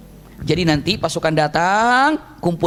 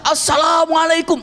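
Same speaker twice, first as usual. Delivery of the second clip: demo shout; 8 semitones higher, slower than usual. Shown as a demonstration. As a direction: stretch the greeting out to the far end. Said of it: "teriakannya luar biasa".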